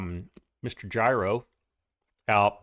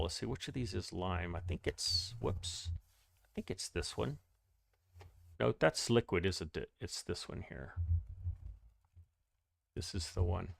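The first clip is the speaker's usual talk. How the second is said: muttering to himself; 5 semitones lower, 12 dB quieter than usual. Real, not acted. Searching the game menu alone.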